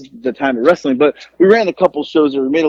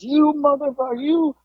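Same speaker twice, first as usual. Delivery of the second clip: high-pitched mock yell of fury; hammed-up imitation of a livid wrestler yelling; imitating a screaming rage; 11 semitones higher than usual. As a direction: replay his furious scream, pitched up. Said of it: "just screaming at us".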